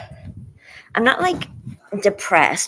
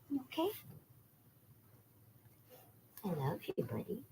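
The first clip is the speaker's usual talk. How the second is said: much softer cooing to the dog; soft pet talk; 4 semitones higher, 20 dB quieter, faster than usual. Real, not acted. Owner softly soothing her anxious dog. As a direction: hush it tenderly.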